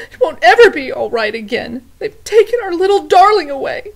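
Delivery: loud, high tearful lament; tearful cook. Acted. Cook mourning the princess, loud high tearful. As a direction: wail it through tears. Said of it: "wipe her tears".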